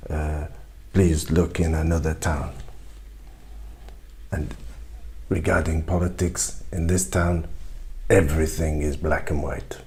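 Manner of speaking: a French shopkeeper regretfully out of ink. Acted.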